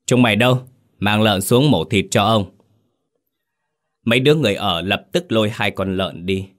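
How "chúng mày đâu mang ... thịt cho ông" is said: calling servants. Acted. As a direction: summon the servants sharply.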